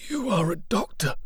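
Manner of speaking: weak soldier asking the doctor; wounded soldier. Acted. Arthur asks the doctor.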